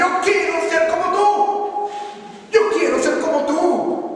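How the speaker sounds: high, loud, slow earnest plea; pleading disciple. Acted. Eliseo begging Elijah to become like him, pleading. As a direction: beg it from the heart.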